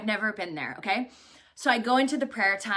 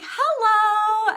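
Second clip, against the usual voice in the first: high, loud, drawn-out hello; friendly greeting; 11 semitones higher, 7 dB louder than usual. Retold or imitated. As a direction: imitate a super kind clerk greeting brightly. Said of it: "the guy was so, so kind".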